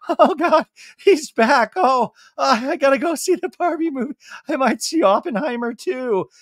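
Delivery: high, loud panicked laugh; tense assistant voice. Retold or imitated. Ann seeing Sandoval return, high panicked voice. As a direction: panic and look for an exit. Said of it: "She's like".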